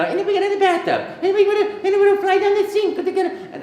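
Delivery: high, rapid Italian cartoon babble; Mario. Shown as a demonstration. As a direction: rattle on gleefully in a squeaky Italian accent. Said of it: "I just kept talking and talking".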